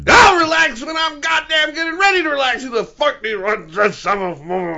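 high, loud enraged rant; mock furious shout. Parent told to relax by youngest, mock raging yell. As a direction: explode in hammed-up outrage.

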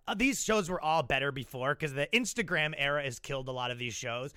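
ordinary talk, nothing marked

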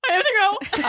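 high, strained little voice, urgently excusing yourself; urgent excuse. Retold or imitated. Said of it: "So I turn softly to her and I go".

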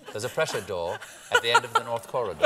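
laughing American try; light, laughing go at American; American accent. Acted.

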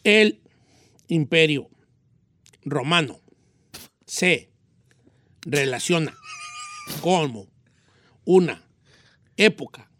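a long pause after each word of "El imperio romano"; low, isolated words from "El" to "época", each ended like a sentence; full stop after every word. Shown as a demonstration. Pupil follows coach's exercise, a full stop after each word from "El" to "época". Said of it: "póngale un punto al terminar".

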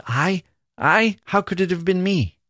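innocent dwarf. Acted.

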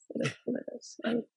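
breathy muttered aside. Real, not acted. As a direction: drop to a near whisper, half to yourself. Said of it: "I think I'm doing it again".

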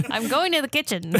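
high, playful mock-vintage voice; hammed-up old-timey reply; old-timey radio voice. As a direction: play along with the old radio bit. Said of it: "all in that old-timey voice".

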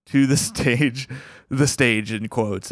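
usual speaking voice

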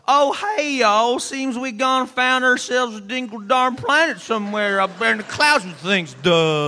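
loud, high hillbilly drawl. Retold or imitated. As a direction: ham up a dim country yokel. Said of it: "You're all".

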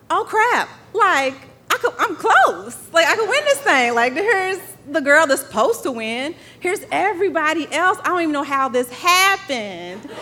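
high, laughing rush of realization; excited disbelief. Retold or imitated. Runner realizing mid-race she could win, excited laughing disbelief. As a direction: gasp at your own chance to win.

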